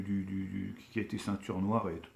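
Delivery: ordinary talk, nothing marked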